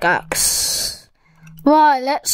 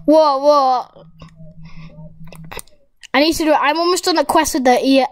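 ordinary talk, nothing marked

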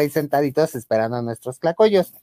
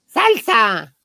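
high, slow hawking call; vendor's call; 7 semitones higher, much slower than usual. Retold or imitated. Vendor offering salsa to customer, high call. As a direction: call out the options like a street vendor. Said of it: "Y decía".

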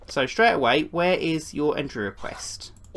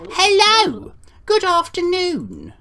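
much higher, louder welcome; bright loud greeting; 12 semitones higher, 6 dB louder, slower than usual. Real, not acted. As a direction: call out a big hello. Greeting next visitor brightly.

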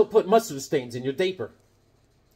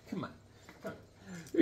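whispered under the breath; 13 dB quieter than usual. Real, not acted. Host alone with Sonia, whispering an aside. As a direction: murmur it as a private aside.

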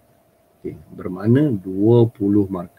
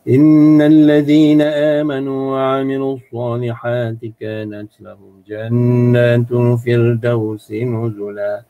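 louder, slow chanted Quran verse; Quran recitation; 5 dB louder, slower than usual. Shown as a demonstration. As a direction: recite it like a qari, stretching the vowels. (bacaan tartil yang lantang)

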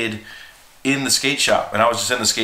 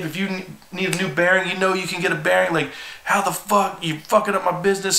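higher, heated retold tirade; angry scolding; 7 semitones higher than usual. Shop owner scolding a kid who stole his sale, heated. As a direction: lay into him, furious but retold. Owner pins him, yelling.

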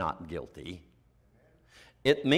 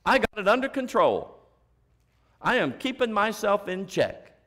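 proud self-reliance; 6 semitones higher, 3 dB louder than usual. Retold or imitated. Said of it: "you know what they mean by that?".

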